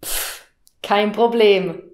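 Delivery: ordinary talk, nothing marked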